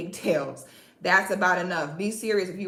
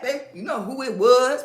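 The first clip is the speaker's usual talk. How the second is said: normal talking voice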